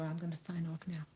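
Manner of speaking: soft voiceless whisper; demure whisper. Real, not acted. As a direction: sign off in a dainty hush. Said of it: "let me be demure".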